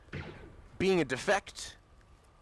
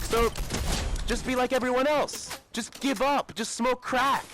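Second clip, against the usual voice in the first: raised, sneering mock voice, pitched higher; mocking society's advice; 4 semitones higher than usual. Mocking the people who tell him to give up, higher sneering voice.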